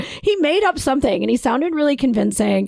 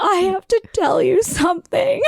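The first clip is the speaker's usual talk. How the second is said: laughing through the line; high laughing confession; laughing retelling; 6 semitones higher than usual. Retold or imitated. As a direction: giggle while confessing.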